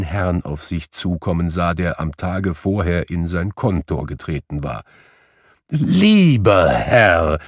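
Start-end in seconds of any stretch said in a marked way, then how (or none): 5.7-7.4 loud, long-drawn hail; calling out. A narrator's reading. Scrooge hailing the gentleman as he hurries up, calling out. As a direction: stretch the words out in an eager call. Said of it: "rief Scrooge".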